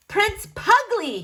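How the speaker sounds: a high, slow, bellowing proclamation; slow, triumphant announcing of his new title; bellowed. Acted. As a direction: proclaim the title to the whole moor. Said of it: "he bellowed".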